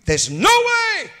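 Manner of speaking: pitched high and slowed; a sudden high sung-out note; preacher's high cry. Acted. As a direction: hold it up high. Sermon climax, high held.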